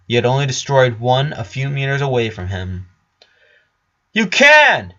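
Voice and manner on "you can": high, loud and insistent; raised voice. Acted. Flower calls to the hidden Richard, raising her voice loud and high. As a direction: call out across the dark warehouse.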